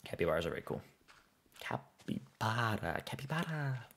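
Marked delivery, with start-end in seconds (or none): 1.6-3.0 breathy whispered name, drawn out; whispering. Pretend. Savoring the animal name. 3.0-3.9 the name squeaked up high; high squeaky voice. Pretend. Repeating the animal name.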